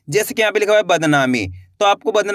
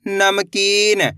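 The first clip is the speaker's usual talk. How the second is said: नमकीन drawn out slowly as नम then कीन; slow blended reading; much slower than usual. Shown as a demonstration. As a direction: stretch नमकीन slowly for the class. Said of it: "पहले नम पढ़ो". (नमकीन धीरे-धीरे जोड़कर)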